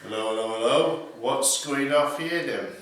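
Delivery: put-on patrolling copper in a Lincolnshire accent, swinging his truncheon; Lincolnshire bobby accent. Imagining himself walking the street as a Lincolnshire police officer. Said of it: "I could be saying".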